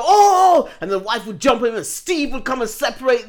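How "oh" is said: loud, high mock audience gasp; big rising "ooh" imitating a scandalised crowd; crowd's shocked "oh". Studio crowd reacting to a guest's confession, mock shocked cry. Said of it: "the crowd would go".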